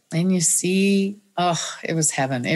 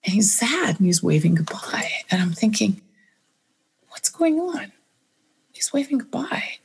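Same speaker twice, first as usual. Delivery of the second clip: hushed whisper; 4 semitones higher than usual. Retold or imitated. Husband waving goodbye, dying.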